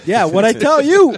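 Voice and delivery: a fan gloating to friends about his prediction. Real, not acted. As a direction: rub it in triumphantly. Gloating over own prediction.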